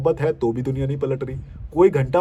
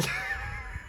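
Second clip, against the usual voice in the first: very high squeaky giggle; squeaky laugh; 11 dB quieter than usual. Real, not acted. Vlogger giggling at a commenter, high squeak. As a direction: let a tiny high giggle slip.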